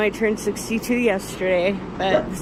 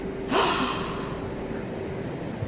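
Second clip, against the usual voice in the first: soft breathless gasp of wonder; awed gasp; 5 semitones higher, 9 dB quieter than usual. Real, not acted. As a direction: catch your breath at the sight. Sees the bird.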